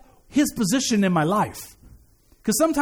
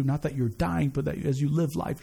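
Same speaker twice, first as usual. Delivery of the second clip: usual speaking voice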